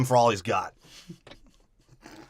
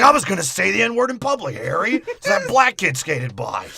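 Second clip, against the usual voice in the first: high, loud cartoon complaint; exasperated burglar; 8 semitones higher, 5 dB louder than usual. Burglar griping at his partner Harry, high comic voice. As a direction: pitch it up as a hammed-up crook. Acted quote of bickering burglar.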